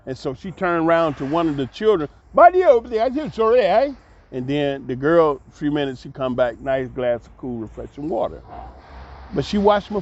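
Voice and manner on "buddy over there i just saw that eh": raised call across the room. Retold or imitated. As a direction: call the kid over loudly. Calling out to a child.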